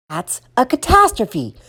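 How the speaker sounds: high-pitched bossy mom; mother laying down the law; ordering. A narrator's reading. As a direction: order the child about in a high voice. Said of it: "she ordered".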